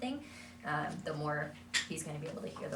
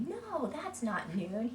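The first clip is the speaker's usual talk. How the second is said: correcting; 5 semitones higher, much slower than usual. Shown as a demonstration. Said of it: "So if we say".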